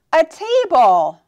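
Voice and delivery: delighted surprised reveal. Shown as a demonstration. Surprised reveal after gasp.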